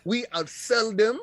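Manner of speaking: slow, indignant put-on Jim Ryan accent; a boss sneering at his rival, in a put-on Jim Ryan accent; a hammed-up Jim Ryan accent; Jim Ryan mock accent. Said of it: "I can't with the accent".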